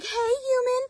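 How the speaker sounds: high, squeaky horse's call to a human. Acted. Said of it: "He just nickered for her".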